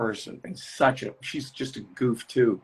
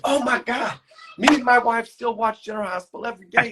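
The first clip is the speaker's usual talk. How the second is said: excited fan; 9 semitones higher, faster than usual. Retold or imitated.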